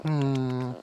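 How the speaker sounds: high squeaky weasel noises; sly hissing weasel; weasel impression. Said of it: "That's my weasel impression".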